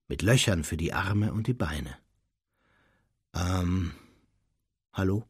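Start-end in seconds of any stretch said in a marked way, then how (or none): 3.3-5.2 hesitant, tentative greeting; unsure. A narrator's reading. Harry greets the strange creature, unsure. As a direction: say it uncertainly, with a pause. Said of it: "sagte Harry unsicher".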